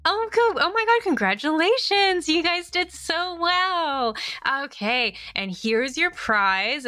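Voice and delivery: high, slow gushing host voice; perky cab host. Acted. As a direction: gush over them like a TV host. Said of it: "She goes".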